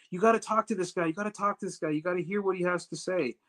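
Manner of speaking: high-pitched imitation of nagging; insistent urging. Saved ladies urging the security head to listen, high and insistent. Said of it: "they kept telling him".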